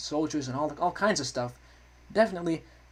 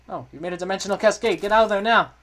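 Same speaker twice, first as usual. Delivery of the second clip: raised voice building to a shout; urgent warning; 5 semitones higher, 7 dB louder than usual. Acted. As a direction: shout the order to escape. Game character's urgent warning read aloud.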